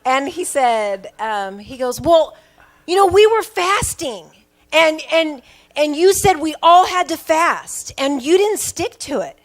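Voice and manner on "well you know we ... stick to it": loud, high mock complaint of a wronged kid; indignant protest. Son calls mom out for breaking the fast, indignant protest. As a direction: imitate a child's emphatic accusation. Son's accusing protest acted out.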